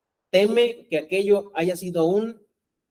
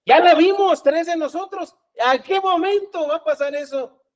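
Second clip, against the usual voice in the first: high, animated imitation of followers; pitched up, insistent mock disciples; voicing impatient disciples; 10 semitones higher, 4 dB louder than usual. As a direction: act out eager disciples pressing for answers. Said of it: "Y ellos ya estaban así".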